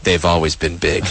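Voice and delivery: smug deadpan. Retold or imitated. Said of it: "And he's like".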